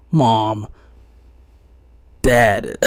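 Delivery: loud, choked cry out; choked call. A narrator's reading. Son calls out to his lost parents, choked and loud. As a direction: call out with a catch in the throat. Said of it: "and calls out chokingly".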